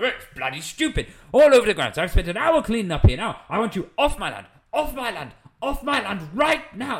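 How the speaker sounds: high, indignant mock tirade; imitated screaming rage. Landowner ordering travellers off his land, imitated screaming rant. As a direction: act a red-faced landowner losing it. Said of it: "He's screaming".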